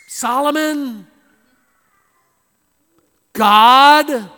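loud preacher mocking the priests' cry; incredulous preaching. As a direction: hammer each name in disbelief. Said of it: "that's exactly what we should be saying".

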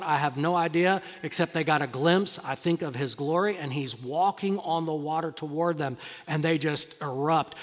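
usual speaking voice

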